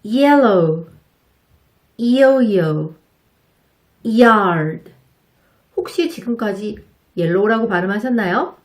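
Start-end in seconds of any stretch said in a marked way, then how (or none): 0.0-4.9 yellow, 이오이오, yard said louder and slow, with a long y glide; Y word drill. Shown as a demonstration. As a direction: stretch the 이 start of yellow and yard. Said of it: "단어 몇 가지 연습을 같이 해볼게요".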